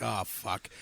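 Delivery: low, quiet sinking oh fuck; retold muttered realization; dismayed mutter. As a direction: let it drop out low and soft. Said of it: "And he's like".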